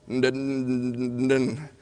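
closed-mouth mumbled syllables; imitated mumble. Father imitating how his son Zach talked, mumbling. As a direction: mimic a child's wordless mumbling. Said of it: "he would just mumble".

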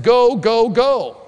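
loud, high cheering on; prophets urging. Acted. Four hundred prophets urge the king to fight, loud and urgent. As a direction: chant it out like an eager crowd.

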